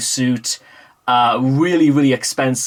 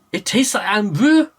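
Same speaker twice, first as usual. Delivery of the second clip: second Scottish voice; 8 semitones higher than usual. Retold or imitated. Vlogger voicing a second Scot, higher Scottish accent. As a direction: answer back in a different mock Scottish voice. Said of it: "the only real Scottish phrase I can actually pull out".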